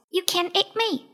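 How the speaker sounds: high little rabbit voice; Clever Rabbit. A narrator's reading. Rabbit shows himself to the lion, high voice. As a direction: pipe up as a plucky small rabbit. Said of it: "said Clever Rabbit".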